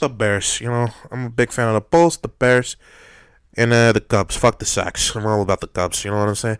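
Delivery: hint of a Chicago guy; Chicago accent. Mock Chicago sports fan.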